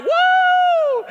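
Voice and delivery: very high shouted woo; mock crowd cheer, high and loud; imitated crowd whoop. Crowd cheering in retold story.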